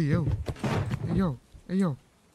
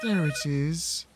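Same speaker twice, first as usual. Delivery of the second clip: odd slow voice; much slower than usual. Pretend. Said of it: "I don't know why I'm talking like this".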